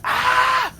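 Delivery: very high loud mock scream; imitated woman's scream. Retelling the five-second scream, very high and loud. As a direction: let out a piercing shriek. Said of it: "It was like".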